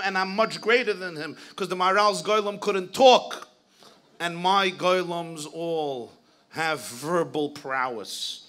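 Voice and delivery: loud, grandly declaimed teacher's boast; booming retold sarcastic boast; mock boast. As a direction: imitate a teacher bragging in sarcasm.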